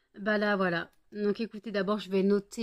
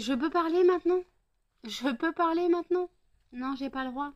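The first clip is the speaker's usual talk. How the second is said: exasperated; 8 semitones higher than usual. Real, not acted. Exasperated at noisy dogs.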